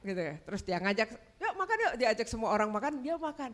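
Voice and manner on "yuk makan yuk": high, sing-song invitation; husband inviting. Retold or imitated. Husband invites everyone to eat, high sing-song. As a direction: call out to eat in his bright voice.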